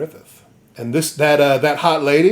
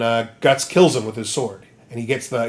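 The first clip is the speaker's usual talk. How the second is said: ordinary talk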